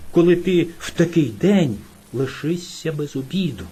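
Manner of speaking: usual speaking voice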